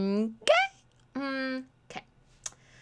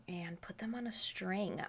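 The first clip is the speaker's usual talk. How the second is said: whispered aside; 2 semitones lower, 10 dB quieter than usual. Real, not acted. Trailing aside while crafting.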